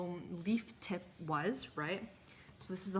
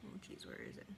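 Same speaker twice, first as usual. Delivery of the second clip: muttered under breath; 13 dB quieter, faster than usual. Real, not acted. Searching for something off-camera.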